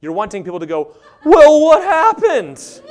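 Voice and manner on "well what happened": high, loud, slow, laughing concern; mock concerned asker. Mimicking friends asking about the sad post, high and loud. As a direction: gush with fake concern. Voicing people who ask.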